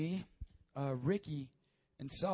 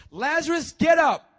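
very loud, high-pitched summons; booming pulpit command; commanding shout; 11 semitones higher, 17 dB louder than usual. Acted. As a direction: shout as if calling the dead out. Acts Jesus raising the dead.

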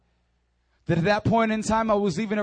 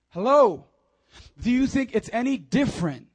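ordinary delivery

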